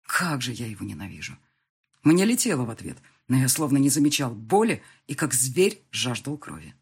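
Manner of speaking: ordinary delivery, nothing marked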